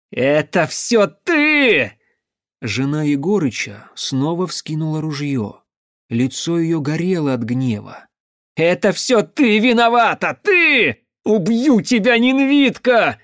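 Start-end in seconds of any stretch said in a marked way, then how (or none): 0.1-2.0 loud, high, drawn-out accusation; angry cry. A narrator's reading. Elder's wife turns on Evgenia, angry cry. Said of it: "вдруг раздался гневный крик". 8.6-13.2 high, loud screamed threat; furious screaming. A narrator's reading. Elder's wife aims the gun at Evgenia, screaming. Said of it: "завопила она".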